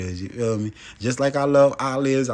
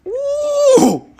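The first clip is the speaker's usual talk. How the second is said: sudden falsetto ooh of delight; high excited squeal; 8 dB louder than usual. Real, not acted. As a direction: squeal at the juicy food. Reacting to juicy dripping lime.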